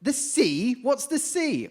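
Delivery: high, baffled squeak of a question; a tiny frog who has never heard of the sea; astonished little frog. Acted. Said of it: "And he says".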